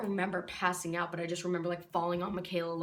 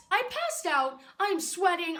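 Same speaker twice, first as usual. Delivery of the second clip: high-pitched exasperated complaint; indignant protest; 8 semitones higher, 4 dB louder than usual. Acted. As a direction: protest as if it should be obvious. Indignant reply to nurse's question.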